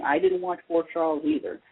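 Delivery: usual speaking voice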